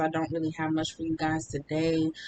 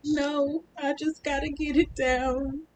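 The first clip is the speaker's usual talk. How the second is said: higher, pushed-out voice through the pain; strained in pain; 9 semitones higher than usual. Retold or imitated. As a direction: force the words out while hurting. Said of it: "And I'm like".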